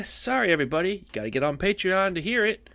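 ordinary talk

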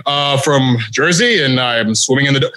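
mumbling low, hesitant mock voice; sheepishly lowballing a wiseguy voice; awkward low voice. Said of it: "you're just like".

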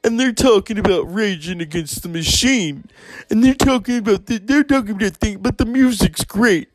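high, sneering put-on voice of a smug critic; mocking conservatives. Mimicking conservatives who call Rage hypocrites, high and snide. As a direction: parrot them in a high, snide tone.

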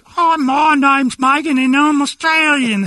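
put-on very high, slow botched Australian accent; badly faked Aussie, stretched out and loud; hammed-up awful Australian impression; Megan.

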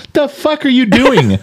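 mock exasperated outburst. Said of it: "I almost called you and go".

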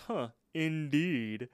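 a hint of posh snob, slow drawn-out huh; lightly voiced snooty diner, slow; fancy person. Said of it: "imitate a, a fancy person".

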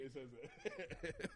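ordinary delivery

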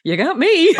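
high, loud showy quote; mock self-assured. Host voicing Jessica to the drama teacher, mock self-assured. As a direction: present yourself with a flourish.

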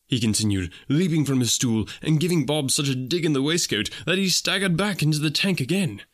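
ordinary delivery, nothing marked

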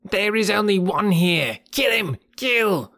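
high, shrill monkey cry to kill; monkey shouting. A narrator's reading. Monkey shrieking to the troop to kill Bagheera. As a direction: scream for the troop to attack. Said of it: "a monkey shouted".

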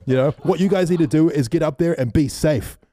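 mock telling off. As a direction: scold them, fed up. Angry stranger lecturing.

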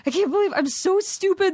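high-pitched retold wail; mock bawling. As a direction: imitate yourself weeping in self-reproach. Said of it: "I just started bawling, like, sobbing, weeping".

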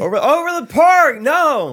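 high, loud mock crowd shout; crowd yelling. The annoyed crowd shouts the town name at the comic. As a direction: yell the correction like an angry crowd. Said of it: "they were mad at me".

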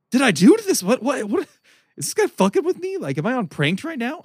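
retelling total confusion at a red light; bewildered. Retold or imitated. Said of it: "like, bewildered".

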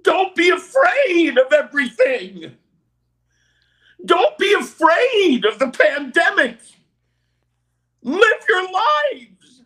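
high, loud, trembling whiny dog yelps; Courage the Cowardly Dog. Shown as a demonstration. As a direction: wail it like a panicked cartoon dog. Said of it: "what is Courage's advice for the world today?".

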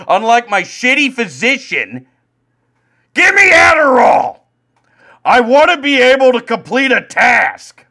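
high, loud desperate yell; frantic shouting. Real, not acted. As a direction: scream it like you're begging for pills. Ranting rage about his doctor.

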